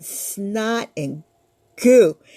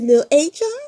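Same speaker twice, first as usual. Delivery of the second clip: mama calling; 4 semitones higher, 6 dB louder than usual. Acted. Mama calls.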